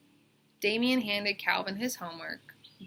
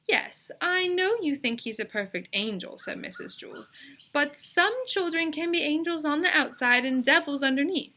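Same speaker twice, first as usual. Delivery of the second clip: earnest teacher; 7 semitones higher, 4 dB louder than usual. A narrator's reading. Teacher scolds mother gently.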